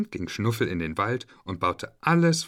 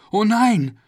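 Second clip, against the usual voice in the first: alarmed cry; 12 semitones higher, 9 dB louder than usual. A narrator's reading. Narrator cries out in alarm.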